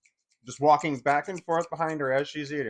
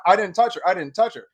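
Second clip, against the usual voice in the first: higher, louder insistence; boy yells back at the husband; yelling innocence; 4 semitones higher, 7 dB louder than usual. Retold or imitated. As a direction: shout him down, protesting. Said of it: "We were both yelling at the same time".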